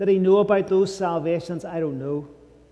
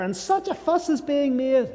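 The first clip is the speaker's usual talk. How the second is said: lightly acted complaint, pitched higher; exasperated housemaid; 7 semitones higher than usual. Housemaid grumbling to the butcher about the visiting preacher, exasperated. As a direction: lift the voice in fussed complaint.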